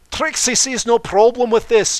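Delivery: loud, high, theatrical third-person boast; booming grandstanding showmare voice; boastful showman Trixie. Acted. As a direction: proclaim it like a stage magician showing off.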